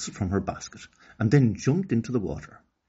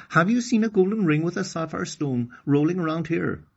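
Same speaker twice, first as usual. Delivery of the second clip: voicing Okani; 9 semitones higher, 4 dB louder than usual. Okani asks the hippo.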